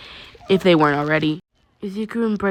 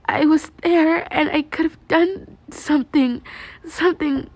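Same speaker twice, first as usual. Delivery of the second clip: high, slow, breaking confession; guilty girl near tears; 10 semitones higher than usual. Acted. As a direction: let the guilt spill out. Held voice of Yaomomo.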